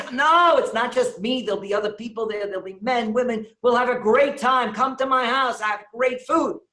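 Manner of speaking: high, loud, eager sales pitch; eager coaxing. Retold or imitated. Host eagerly coaxing Weiner to the Shabbos meal, excited. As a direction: sell him on the dinner.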